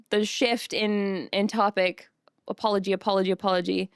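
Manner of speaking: ordinary talk, nothing marked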